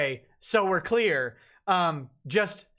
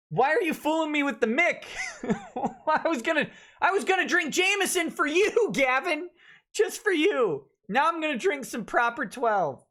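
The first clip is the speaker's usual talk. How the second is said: high-pitched, hammed-up protest; mock complaint; 8 semitones higher than usual. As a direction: play at being let down, voice pitched way up. Mock complaint to Gavin over the name.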